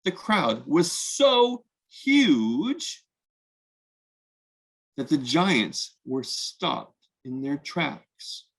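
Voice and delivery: usual speaking voice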